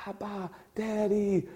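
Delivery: put-on Abba, Daddy said slowly and softly like a toddler; like a little boy. Shown as a demonstration. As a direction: coo it gently, slow, like a small child's first words. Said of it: "like a little boy is just learning how to speak".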